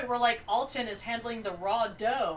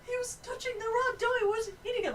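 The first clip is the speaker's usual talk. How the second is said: high-pitched self-justifying protest; retold protest; 13 semitones higher, faster than usual. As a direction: plead the case in a high voice. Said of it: "I'm like".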